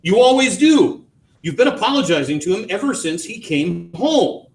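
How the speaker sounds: high, biting reproach; bitter taunt. A narrator's reading. Wife accusing husband of always apologizing, bitter and high.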